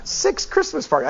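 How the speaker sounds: high-pitched, incredulous echo. Real, not acted. Preacher marvels at staffer's packed Christmas calendar, incredulous. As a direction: repeat it in high-voiced disbelief. Repeats it, can't take it in.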